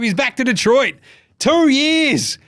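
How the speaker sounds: high, excited proclamation; hyped announcement. Real, not acted. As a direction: proclaim it high, like a big homecoming. Hyping a player's return.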